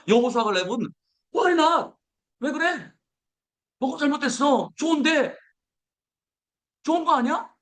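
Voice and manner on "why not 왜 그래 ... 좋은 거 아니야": high, bright eager reply; high eager yes; eager why not. Pretend. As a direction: answer with cheerful eagerness. Voicing cheerful Joshua and Caleb.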